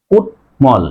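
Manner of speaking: কুটমল split as kut, mol; syllable by syllable. Shown as a demonstration. Teacher shows students the word, split and clear কুটমল. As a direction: sound t then m in কুটমল. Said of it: "এখানে উচ্চারণ হবে কি".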